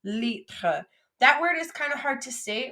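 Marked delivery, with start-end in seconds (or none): none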